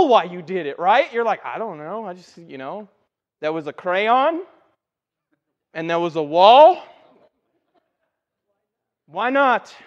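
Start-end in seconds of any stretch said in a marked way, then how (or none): 1.4-9.7 lightly acted kid, questioning rises; sheepish child excuse. Child explaining crayon on the wall to parents, mock-innocent excuse. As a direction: start small, end each phrase rising louder.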